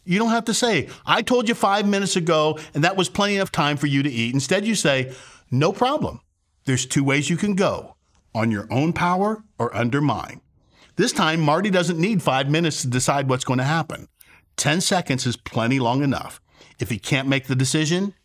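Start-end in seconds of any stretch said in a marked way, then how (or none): none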